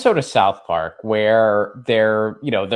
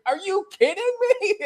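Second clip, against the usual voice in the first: much higher, mock-shocked squeak; hammed-up outraged squeal; incredulous; 4 dB quieter, faster than usual. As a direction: play it as stunned disbelief. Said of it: "And he's like".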